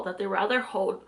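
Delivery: ordinary delivery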